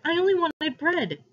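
high-pitched protest; crying out. A narrator's reading. Boy explaining himself to his captor, high cry. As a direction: plead upward while pulling away. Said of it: "I cried, trying to pull back".

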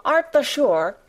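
high, insistent question; astonished cry. A narrator's reading. Martha questioning Mary, high astonished cry. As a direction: pitch it up in disbelief. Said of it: "cried Martha".

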